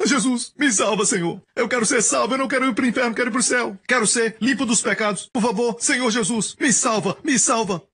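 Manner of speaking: high, pleading cry for salvation; imitated desperate prayer. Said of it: "Como você tem orado?". (imitando uma oração desesperada, bem aguda)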